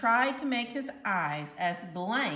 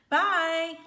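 bright, higher and louder bye; cheery goodbye; 8 semitones higher, 6 dB louder than usual. A narrator's reading. Nick leaving the classroom, cheery higher bye. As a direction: toss it off cheerfully.

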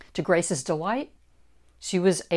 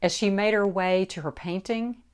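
ordinary delivery, nothing marked

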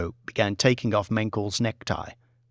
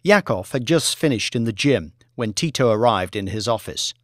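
plain reading voice

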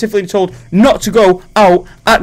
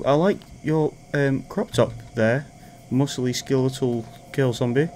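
softer, coaxing sweet talk; butter the zombies up so they spare you; mock flattery; 3 semitones lower, 9 dB quieter than usual.

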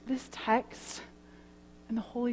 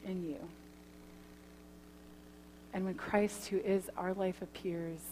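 closing prayer; 3 dB quieter than usual. Acted. Pastor praying for the congregation, soft and reverent. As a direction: pray it quietly for the gathered church.

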